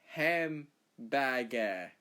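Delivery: "Hamburger" stretched very slowly in a mock Australian accent; Australian pronunciation. Shown as a demonstration. Australian showing local vowels, exaggerated Australian accent on "Hamburger". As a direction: draw out each vowel of "Hamburger" like a caricature Australian. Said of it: "we don't say, uh, we say".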